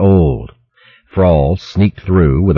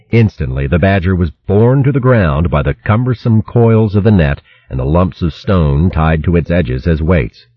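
plain reading voice

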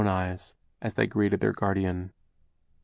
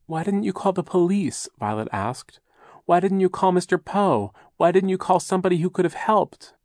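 upset demanding; 10 semitones higher, 4 dB louder than usual. A narrator's reading. Violet demands angrily.

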